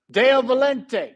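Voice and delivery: forceful, on your heels; shouting match. Shown as a demonstration. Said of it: "say it like you're in a shouting match".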